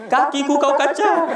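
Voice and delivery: high, pained whine, played for laughs; mock tearful complaint. Pilgrim complaining to his guide about his foot, mock crying. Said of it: "nyari nginyan Bapak nangis".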